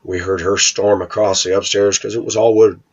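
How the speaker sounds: ordinary delivery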